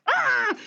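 short high-pitched yelp; retold outburst as a squeal; acted exasperated "ah"; high mock shriek. Acting out her own exasperated reaction.

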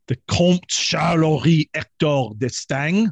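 slow, showy French accent; French-accented flourish on a noble's name. Shown as a demonstration. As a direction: roll out the name in your best French accent. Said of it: "That's my best French, uh, accent".

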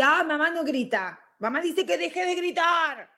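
high mock kid shout; child imitating Mom's yelling. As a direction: copy a yelling mother as a child. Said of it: "yo estoy imitando lo que mamá está haciendo".

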